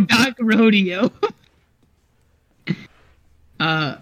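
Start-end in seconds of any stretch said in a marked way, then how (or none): 0.0-1.1 teasing echo of the wrong name; mocking. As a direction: say it back like a joke. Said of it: "so it's not modok Rodeo".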